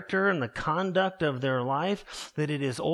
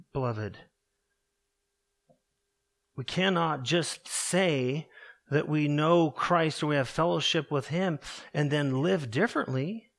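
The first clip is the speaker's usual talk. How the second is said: ordinary talk, nothing marked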